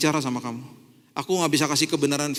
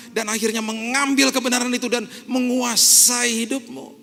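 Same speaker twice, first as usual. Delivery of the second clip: God scolding; 6 semitones higher than usual. Pretend. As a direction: voice God fed up with immature believers. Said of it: "Tuhan datang ke kita bilang".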